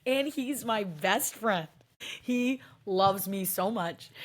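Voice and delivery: slow, doting words about the dog; fond. Real, not acted. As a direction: gush over your pet.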